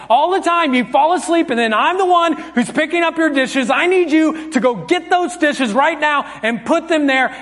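high-pitched, heated parental scolding; acted angry yelling. Dad scolding sleepy daughter over dishes, acted angry yelling. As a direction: rant at the child in rising frustration. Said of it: "you need to apologize for yelling".

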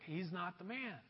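very soft, calm verdict; God quietly repeating the no; quiet denial. Acted. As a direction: say it almost under the breath. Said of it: "He says, like".

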